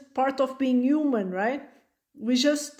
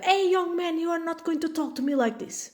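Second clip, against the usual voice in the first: put-on a higher, stern parent telling off a child; stern scolding; 6 semitones higher than usual. Parent scolding a rude child, imitated stern telling-off. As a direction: put the kid in his place, mock-stern.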